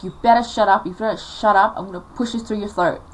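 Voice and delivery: mildly acted angry threat, loud at first; retold threat with loud, forceful opening; threatening. Mum threatening crying daughter with fly stick, threatening.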